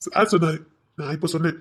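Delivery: normal talking voice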